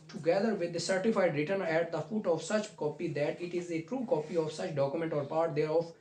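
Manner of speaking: usual speaking voice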